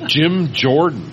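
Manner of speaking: laughing while reading the name. Real, not acted. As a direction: laughs out the name Jim Jordan. Laughing at surprising award name.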